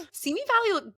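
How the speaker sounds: high gasped-out exclamation; gasping excitement. Real, not acted. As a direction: burst out thrilled.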